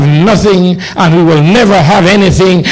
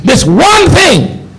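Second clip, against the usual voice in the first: rising, shouted preaching on one spirit; preaching at full force; 6 semitones higher than usual. Acted. Preacher to his congregation, naming the spirit of poverty in full cry. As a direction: hammer it home like a revival preacher.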